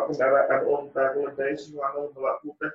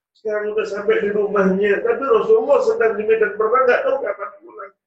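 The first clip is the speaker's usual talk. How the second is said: louder, raised cry of frustration; loud lament; 6 semitones higher, 8 dB louder than usual. Acted. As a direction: wail that he came all this way in vain. Uwais laments missing the Prophet. (ratapan keras penuh kecewa)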